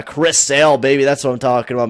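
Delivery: hyped, fast and loud; excited cheer. Real, not acted. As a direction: celebrate like a fan. Hyped player makes All-Star.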